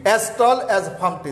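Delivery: drawn out word by word; slow, careful example; slow example reading. Shown as a demonstration. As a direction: dictate "He is as tall as" very slowly. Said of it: "যেমন".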